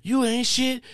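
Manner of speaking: high, rapid-fire angry woman; imitation of a furious woman, high-pitched; imitating her cussing. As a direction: rattle off insults in a high mock rant. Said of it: "Because she said".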